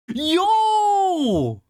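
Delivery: high, long real whoop of delight; excited yell. Real, not acted. Streamer seeing a viewer's art, real excited yell. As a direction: cry out in delighted amazement.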